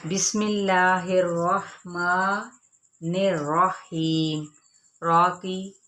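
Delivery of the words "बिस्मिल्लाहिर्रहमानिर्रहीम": slow, drawn-out chanted recitation; slow tajweed chant; Quran recitation. Shown as a demonstration. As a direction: chant it slowly, stretching the long vowels. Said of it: "खींचते हुए".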